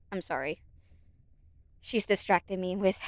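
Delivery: normal talking voice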